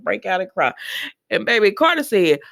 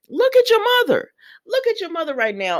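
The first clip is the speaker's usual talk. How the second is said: scolding shout; 10 semitones higher, faster than usual. Retold or imitated. Ridge scolding Thomas at wedding.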